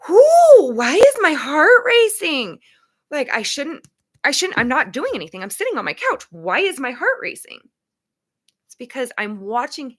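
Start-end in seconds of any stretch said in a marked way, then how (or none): 0.1-7.6 high and loud, startled whoo then puzzled; mock alarmed. Describing watching a plane jump, sudden high loud alarm. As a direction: act out a racing heart, baffled on the couch. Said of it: "And I'm like".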